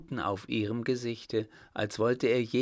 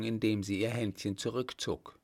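plain reading voice

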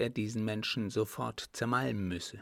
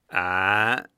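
loud, menacing "ah"; ominous grumbling old captain; deep rumbling growl; 11 dB louder than usual. A narrator's reading. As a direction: growl it from the chest. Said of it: "fragte er in tief grollendem Tone".

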